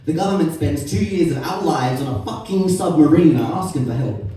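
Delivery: furious tirade. Acted. Poet raging at the government over war spending, loud and furious. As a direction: spit the words out at the government.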